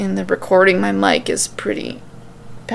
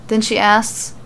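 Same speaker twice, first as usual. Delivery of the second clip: ordinary delivery, nothing marked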